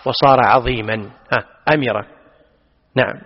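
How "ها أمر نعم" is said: usual speaking voice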